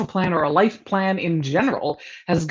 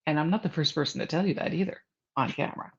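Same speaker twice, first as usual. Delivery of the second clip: normal talking voice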